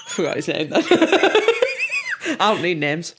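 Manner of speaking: talking through laughter; giggling while speaking; laughing. Real, not acted. Laughing at own slip.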